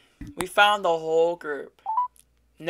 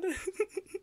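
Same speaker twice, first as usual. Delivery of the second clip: laughs; 12 semitones higher, 15 dB quieter than usual. Real, not acted. Laughing in delight.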